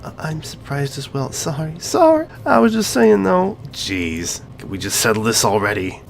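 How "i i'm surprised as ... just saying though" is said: mocking quote. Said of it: "You literally just said".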